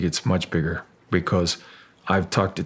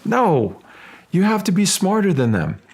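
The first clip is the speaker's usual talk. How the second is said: quoting the guide, much higher and louder, laughing through it; laughing guide quote; 9 semitones higher, 4 dB louder than usual. Retold or imitated. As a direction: still laughing while mimicking the guide. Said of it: "And he says".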